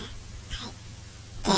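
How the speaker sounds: ordinary talk, nothing marked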